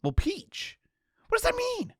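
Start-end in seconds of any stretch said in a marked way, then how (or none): 1.3-1.9 much higher, baffled; incredulous. Real, not acted. Gamer baffled by odd dialogue, pitched way up. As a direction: squeak it out in disbelief.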